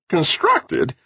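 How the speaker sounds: high-pitched squeak of disbelief; startled little squeak. A narrator's reading. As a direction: squeak the word out in astonishment. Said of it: "Longyear squeaked".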